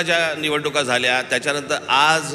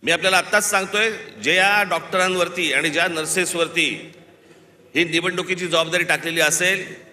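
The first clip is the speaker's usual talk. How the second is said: higher, fiery orator's attack; rally speech; 4 semitones higher than usual. Real, not acted. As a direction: rouse the crowd, mocking and indignant. Fiery attack on election duties. (तडाखेबंद सभेचे भाषण)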